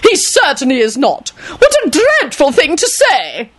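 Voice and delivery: put-on high, outraged old lady; indignant lady. A narrator's reading. Lady Fanshawe denies his death, indignant. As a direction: protest it high, drawing out the end.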